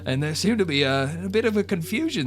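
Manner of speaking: high, eager barkeep explaining; chatty innkeeper York. Acted. York tells Lungori about the strange new guests, high and eager. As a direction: lift into the chipper innkeeper voice.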